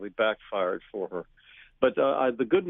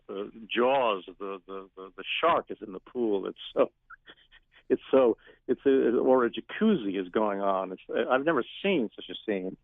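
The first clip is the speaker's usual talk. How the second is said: ordinary talk, nothing marked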